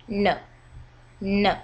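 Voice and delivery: "no" falling high to low. Shown as a demonstration. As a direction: drop "no" firmly from the top. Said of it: "It goes from high to low".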